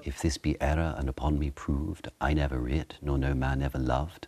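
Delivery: posh recited Shakespeare accent. Shown as a demonstration. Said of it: "the posh one you would have taught it".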